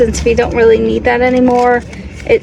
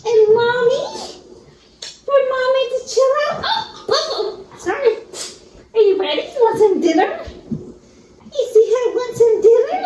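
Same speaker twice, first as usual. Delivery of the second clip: pet talk; 9 semitones higher than usual. Real, not acted. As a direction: coo at the dog about dinner. Talking to dog.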